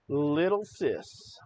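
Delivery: slow, drawn-out taunt; mocking nickname. Real, not acted. Host taunting Harry Sisson with his nickname, mocking. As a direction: draw out LITTLE SIS, teasingly.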